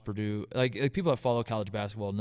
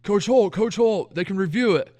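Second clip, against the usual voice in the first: higher, louder urgent call; calling out to coach; 7 semitones higher, 5 dB louder than usual. Retold or imitated. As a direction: imitate a staffer hailing the coach. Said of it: "stands up and goes".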